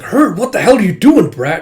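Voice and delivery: high, loud pained outburst; angry yell. Retold or imitated. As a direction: snap at the girl after the bite. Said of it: "it's like".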